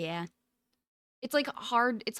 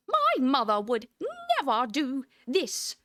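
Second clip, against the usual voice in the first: a hint of a snooty British grandma; posh British old lady; 2 semitones higher, 4 dB louder than usual. Shown as a demonstration. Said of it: "how do I even get her voice?".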